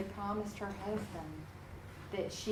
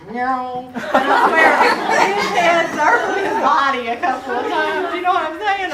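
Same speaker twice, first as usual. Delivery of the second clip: loud, laughing, higher voice; laughing loudly; 7 semitones higher, 17 dB louder than usual. Real, not acted. Teacher laughing to her class about the surgeon's glare. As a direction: laugh through the disbelief.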